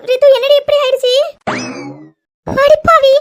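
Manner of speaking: loud, high exclamation of dismay; aghast. Acted. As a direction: cry out in shocked reproach. Friend scolds her mix-up.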